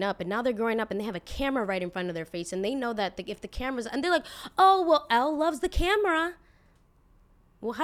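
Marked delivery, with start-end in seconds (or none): 4.6-6.3 mock-gushing, loud and high; loud sing-out of fake delight; gushing vlogger parent. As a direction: imitate an overexcited parent showing off. Mocking gushing family vlogger parents.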